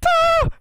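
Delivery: high startled scream; a sudden high shriek of fright. Real, not acted. Player jumps at the witch and screams.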